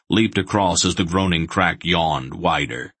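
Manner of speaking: ordinary delivery, nothing marked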